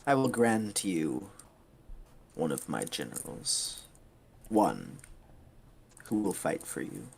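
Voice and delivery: a hint of a ruler's decree; lightly voiced commander. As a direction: grant it with measured authority. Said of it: "she goes".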